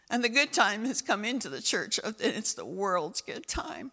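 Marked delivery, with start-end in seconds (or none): none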